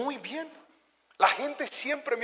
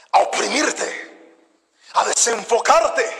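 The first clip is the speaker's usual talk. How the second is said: loud, driving sermon voice; fiery, louder pulpit delivery; preaching at full force; 4 semitones higher, 9 dB louder than usual. Acted. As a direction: preach hard to the congregation.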